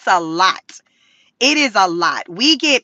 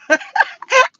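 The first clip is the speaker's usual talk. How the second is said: a burst of high laughter; 11 semitones higher than usual. Real, not acted. To viewers about the thumbnail, a high burst of laughter. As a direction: laugh hard at your own reaction.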